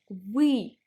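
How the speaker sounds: polite form вы emphasised. Shown as a demonstration. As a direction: lean on вы for politeness. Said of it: "if you want to sound more polite".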